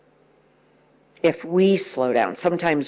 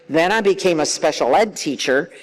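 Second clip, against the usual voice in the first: ordinary delivery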